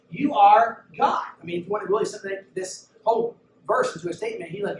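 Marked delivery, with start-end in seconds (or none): 0.1-1.2 "You are God" drawn out slow and louder; proclaimed confession. Acted. Preacher voicing Peter to Jesus, slow loud proclamation. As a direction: declare it like a ringing confession.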